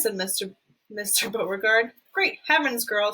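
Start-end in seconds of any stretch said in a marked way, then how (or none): none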